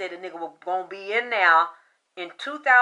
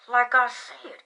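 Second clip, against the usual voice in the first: usual speaking voice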